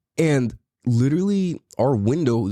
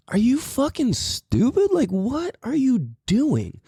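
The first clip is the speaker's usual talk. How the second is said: incredulous; 12 semitones higher, slower than usual. Retold or imitated. Ranting at neighbor in his spot.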